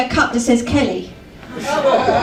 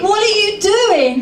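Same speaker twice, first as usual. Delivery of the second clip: higher, startled and laughing; shocked question; 7 semitones higher than usual. Retold or imitated. To undressing boyfriend, laughing startled question. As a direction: catch yourself in mock alarm.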